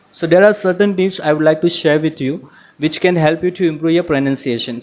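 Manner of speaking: ordinary talk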